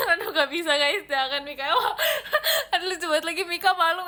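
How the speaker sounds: high, loud laughing through the words; squealing with embarrassed laughter; flustered laughing. Real, not acted. Flustered by chat teasing.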